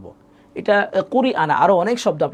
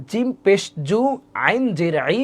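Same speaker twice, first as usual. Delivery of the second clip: measured spelling, each unit set apart; broken-up, joined-step spelling. Shown as a demonstration. Teacher shows how she spells, measured step by step. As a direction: draw out each letter, pausing between units. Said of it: "আমি বানান করি, খেয়াল করেন, এভাবে".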